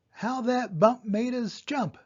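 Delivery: ordinary delivery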